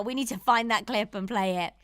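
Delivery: normal talking voice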